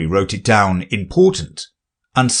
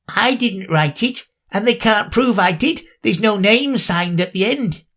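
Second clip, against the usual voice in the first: high pleading knave; 12 semitones higher than usual. Acted. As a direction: plead nervously.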